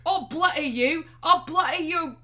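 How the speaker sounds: put-on high, very loud botched Scottish accent; mock Scottish accent. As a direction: shout a hammed-up threat in a shaky-aimed Scottish accent. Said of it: "that's more like Old English".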